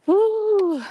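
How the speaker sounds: high panting woo from the climb; breathless woo. Real, not acted. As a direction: blow out a winded woo. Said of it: "It's hard to talk and walk up a hill".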